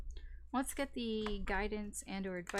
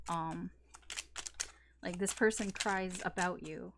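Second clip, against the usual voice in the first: ordinary talk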